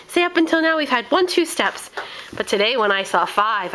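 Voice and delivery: ordinary talk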